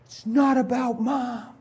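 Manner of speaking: high, drawn-out crying protest; upset son. Retold or imitated. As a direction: imitate a distraught boy wailing at his dad. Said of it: "He pushed me and said".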